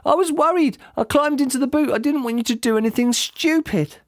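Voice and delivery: put-on high child's voice, anxious; a boy pleading his reasons; young boy, worried. A narrator's reading. Son explains to dad why he climbed in, worried.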